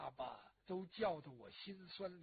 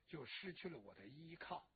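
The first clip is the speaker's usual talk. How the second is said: softer and lower, 就失去了我的依靠 with a small lift on 去; sinking grief; 4 semitones lower, 8 dB quieter than usual. Acted. Daughter mourning her father, sinking to a soft voice. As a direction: let 就失去了我的依靠 drop away quietly.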